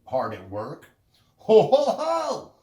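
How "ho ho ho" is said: high, loud teasing ho ho ho; mocking laugh. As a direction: jeer at your busy brother. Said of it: "laughed the second little pig".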